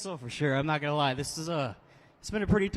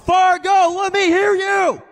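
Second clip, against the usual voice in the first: even higher, louder, slow drawn-out yell; bigger crowd call; 16 dB louder, much slower than usual. Real, not acted. Driver urging the Fargo crowd to get louder, big drawn-out yell. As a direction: push the crowd to roar. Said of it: "I think you get a little louder".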